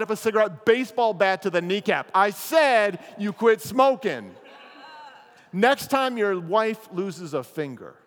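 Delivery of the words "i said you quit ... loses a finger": slow, loud laughing shout, a hammed-up heavy; thug's threat. Enforcer threatening the man who lit up again, slow laughing shout. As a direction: bark the threat slowly while cracking up.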